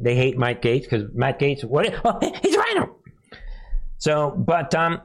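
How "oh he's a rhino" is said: very high mocking whine; mock accusation. Mimicking super loyal haters of the congressman, very high mock accusation. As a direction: squeal the accusation in mockery.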